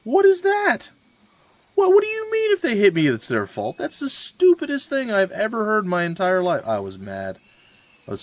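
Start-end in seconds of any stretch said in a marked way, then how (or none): 0.0-6.7 high, loud retold outburst; exasperated mock tirade, much higher in pitch; disbelieving rant. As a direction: blast the father-in-law for his logic. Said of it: "I'm like".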